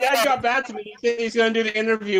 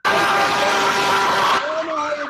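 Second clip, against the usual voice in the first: slow mocking shout; 3 semitones higher, 3 dB louder, much slower than usual. Real, not acted. Caller taunting the streamer.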